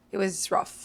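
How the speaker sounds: usual speaking voice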